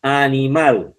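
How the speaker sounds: "Animal" with the weight on "mal"; teacher's demo of "Animal", accent on "mal"; stress on last syllable. Shown as a demonstration. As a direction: lean on the final syllable of "Animal". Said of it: "en la sílaba mal".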